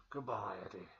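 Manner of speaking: low, dark monster farewell; Venom voice. Retold or imitated. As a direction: growl it like the symbiote. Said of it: "Venom says to him".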